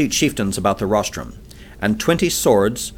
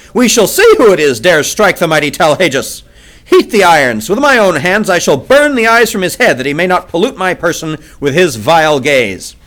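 loud, high ranting fury; raging threat; 6 semitones higher, 7 dB louder than usual. A narrator's reading. Tal Hages threatens his prisoner in a loud rage. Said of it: "he shrieked".

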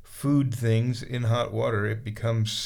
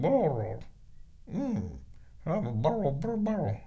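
imitating little cat grunts, a touch higher; mock the cat's burr sounds back at her; kitty grunts; 3 semitones higher, 3 dB quieter, slower than usual. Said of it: "doing little kitty grunts over there going".

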